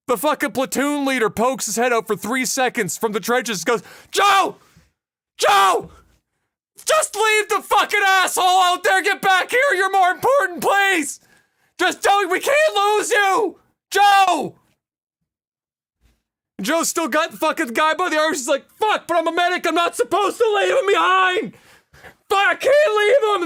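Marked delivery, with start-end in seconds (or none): 4.1-14.5 high, loud battlefield yell; desperate shouting. Acted. Platoon leader calls from the trenches. 18.7-23.4 high, strained shout back; anguished shouting. Acted. Joe shouts back in distress.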